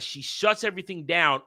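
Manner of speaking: ordinary talk, nothing marked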